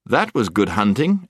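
usual speaking voice

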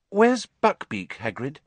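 slow, unsure question; tentative and slow; hesitant. Acted. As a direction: ask gently, afraid of the answer. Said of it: "said Hermione hesitantly".

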